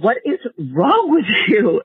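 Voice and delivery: impatient, puzzled man's voice; a husband's irritated question; exasperated husband. Retold or imitated. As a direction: demand it, baffled and annoyed. Man confronting crying wife.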